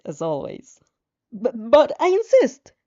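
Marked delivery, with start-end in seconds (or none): 1.3-2.7 louder, higher and slow, tripping over the words; stammering. Acted. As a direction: push through the nerves, stumbling. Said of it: "you stammer".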